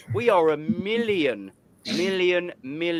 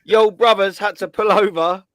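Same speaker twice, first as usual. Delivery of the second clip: put-on raised, louder take on a friend's accent; imitating Matty's accent; 6 semitones higher, 6 dB louder than usual. Host starts a friend's comment, imitating his accent. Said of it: "gonna do it all in his accent".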